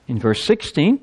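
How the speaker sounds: usual speaking voice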